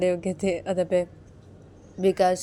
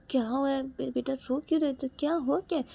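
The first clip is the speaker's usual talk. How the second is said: higher gentle questioning; coaxing child; 7 semitones higher than usual. Acted. Father asking his crying child what happened, coaxing high voice.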